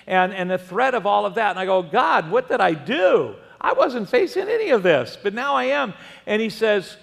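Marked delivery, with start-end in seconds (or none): 1.8-5.9 high, loud retold plea; a desperate prayer remembered aloud; pleading to God. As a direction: cry out to God in disbelief.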